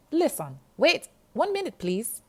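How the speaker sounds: put-on high, loud, urgent Jamaican girl voice. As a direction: pitch it way up and press the caller to wait. Jamaican girl speaks into phone.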